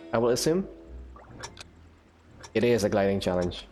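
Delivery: normal talking voice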